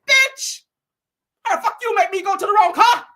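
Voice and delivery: high, loud cussing-out; hammed-up indignant yell at the helpers; mock angry outburst. Acts out Wendy cussing her people.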